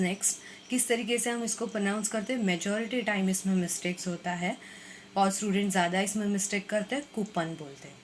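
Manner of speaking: ordinary delivery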